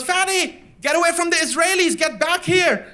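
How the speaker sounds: high-pitched frantic yelling; imitated shrieking mom; panicked mother's call. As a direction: call the child back in panic. Mother calls child from tanks.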